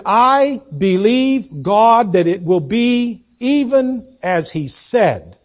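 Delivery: drawn-out, deliberate creed; slow declaration of faith. Acted. Preacher voices faith's answer, slow and firm. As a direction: speak faith's words slowly and firmly. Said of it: "faith says".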